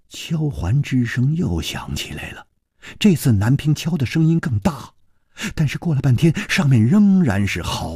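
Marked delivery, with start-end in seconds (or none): none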